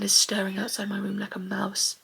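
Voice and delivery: wary whispered aside; hushed whisper. Real, not acted. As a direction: whisper so nobody outside hears. Hushed, someone outside the room.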